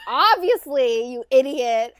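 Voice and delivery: loud, drawn-out mock exasperation; exasperated retort. Acts exasperated reply from retold scene.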